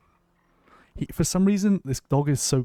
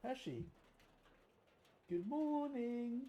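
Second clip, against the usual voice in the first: sing-song good morning; 8 semitones higher, 20 dB quieter, slower than usual. Acted. Greeting the dog again.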